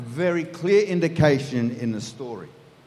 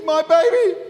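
much higher, louder, hammed-up tearful cry; operatic wail; 9 semitones higher, 6 dB louder than usual. Mother bursting into tears over her son, melodramatic wail. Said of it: "bursts into tears and says".

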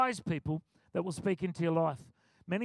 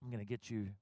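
low, soft menacing mutter; muttered threat; 9 semitones lower, 11 dB quieter than usual. Retold or imitated. Angry man muttering a threat on the way out, low. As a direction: growl it under your breath as you walk past. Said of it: "he goes".

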